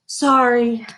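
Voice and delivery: sharp gasp for breath, then a loud sorry. A narrator's reading. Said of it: "Lily said to Mister Slinger".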